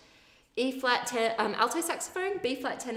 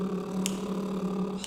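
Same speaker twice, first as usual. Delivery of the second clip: long buzzing lip trill. Shown as a demonstration. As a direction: blow a steady lip buzz. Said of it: "this time".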